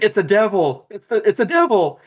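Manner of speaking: high, frightened meme voice; mock terror.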